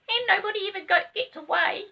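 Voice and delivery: a little rabbit, cheerfully ominous; second rabbit asking for cake. Acted. Other rabbit.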